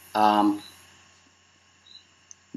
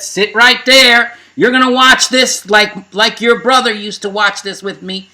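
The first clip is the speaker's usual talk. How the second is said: high, insistent retold order; mock-bossy, pitched up and emphatic; bossy command; 4 semitones higher, 3 dB louder than usual. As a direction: imitate a niece laying down the law. Niece ordering her to watch, retold.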